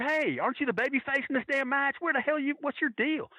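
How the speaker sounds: high-pitched heckle, no real shouting; imitating a fan yelling at a wrestler; retold yell. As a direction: call out a mock indignant challenge from the crowd. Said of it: "And I yelled at him".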